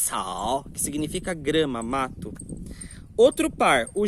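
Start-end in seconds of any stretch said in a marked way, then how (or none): 0.1-0.6 Cao com sopro forte de ar, tom baixo; aspirated cao. Shown as a demonstration. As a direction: solte bastante ar no Cao. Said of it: "Você vê como que sai ar quando eu falo?". (Cao aspirado, com sopro de ar)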